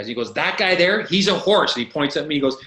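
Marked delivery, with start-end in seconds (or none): 0.3-1.7 loud, raised-pitch retold scolding; steaming coach. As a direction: imitate an angry coach singling someone out. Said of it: "he's just steaming".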